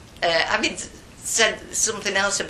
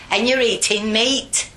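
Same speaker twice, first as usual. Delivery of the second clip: aghast, high, loud scolding; shocked telling-off; 7 semitones higher, 9 dB louder than usual. Retold or imitated. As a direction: act out horrified disapproval. Acts shocked telling-off over meat.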